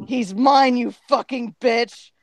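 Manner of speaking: hammed-up jealous outburst; possessive cry. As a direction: stake the claim sharply. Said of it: "cried and then looked at me".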